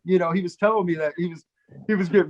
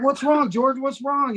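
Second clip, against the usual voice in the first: higher-pitched mock worry; feigned concern; 8 semitones higher, slower than usual. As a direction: play along with the prank, asking urgently. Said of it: "trying to play along too".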